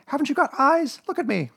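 high, loud, squeaky demand; Piglet shouting. A narrator's reading. Piglet demanding Kanga look at him, shouting. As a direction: cry out indignantly in a small high voice. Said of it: "shouted Piglet".

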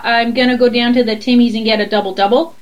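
light Canadian demo of Timmy's and a double double; Canadian accent. Shown as a demonstration. Canadian coaching her Southern friend, lightly Canadian. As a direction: show her how a Canadian says it. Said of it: "You just have to go".

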